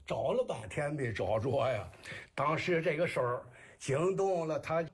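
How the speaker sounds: comic kuaishu storytelling in Jinan dialect. Shown as a demonstration. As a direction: tell it as Shandong rhymed patter. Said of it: "您这个济南话". (济南话山东快书)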